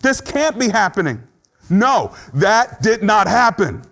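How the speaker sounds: high, loud disbelieving protest; high loud voice of someone in denial; voicing denial. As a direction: refuse to believe it, pleading and insistent. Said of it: "This stage is when you say".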